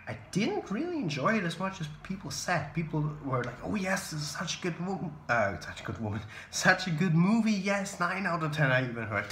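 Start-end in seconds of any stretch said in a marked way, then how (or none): 3.6-8.7 mock gushing, higher pitched; retold rave in a raised pitch; imitating hype. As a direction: gush like an overexcited fan. Quoting people hyped about film.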